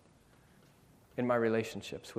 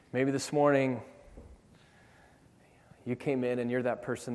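altar call. Acted. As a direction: gently invite the weary to come home. Altar call, quiet invitation.

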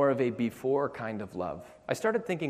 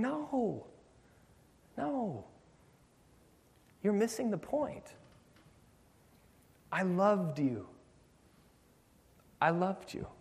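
gentle God; 9 semitones higher, slower than usual. Acted. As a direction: gently tell them they miss the point. God answering the striver tenderly.

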